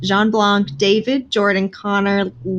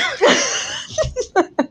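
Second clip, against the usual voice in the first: laughing. Real, not acted.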